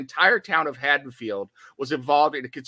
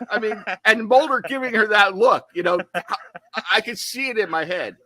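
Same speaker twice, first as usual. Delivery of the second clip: higher-pitched, talking through laughs; amused laughter; 6 semitones higher, 4 dB louder than usual. Real, not acted. Podcaster picturing Mulder's look, amused and laughing. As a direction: giggle through the words. Cracking up at own impression.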